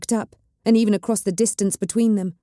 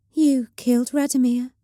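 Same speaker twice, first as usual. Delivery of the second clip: higher, flat and dispassionate; flat witch voice; 6 semitones higher than usual. A narrator's reading. The witch over Radomir's body, higher flat voice. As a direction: state it without feeling. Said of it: "Her voice was flat".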